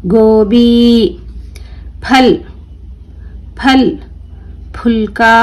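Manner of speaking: each word drawn out slowly, clearly; careful drawn-out classroom reading; slow word-list reading. Shown as a demonstration. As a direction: read it slowly for children to repeat. Teacher reads word list aloud.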